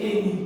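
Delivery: এ নি starting on an এ vowel; A sounded as এ. Shown as a demonstration. Teacher giving any as an example, এ নি said with এ. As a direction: say এ নি with the first sound like একার. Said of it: "একারের মতো উচ্চারণ হচ্ছে".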